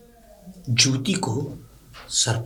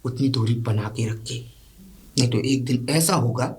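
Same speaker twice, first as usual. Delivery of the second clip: normal talking voice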